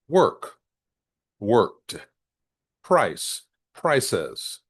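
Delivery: "worked" with a crisp final t, "prices" with a full second syllable; word by word, endings of "worked" and "prices" sounded out; clear word endings. Shown as a demonstration. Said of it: "pronounce S, E, D clearly".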